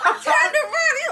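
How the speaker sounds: cracking up mid-sentence; laughing too hard to talk. Real, not acted. Mom laughing retelling car story.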